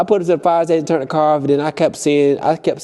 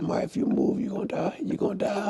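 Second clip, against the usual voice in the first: hushed threat; 6 semitones higher, 11 dB quieter than usual. Retold or imitated. Whispered quote.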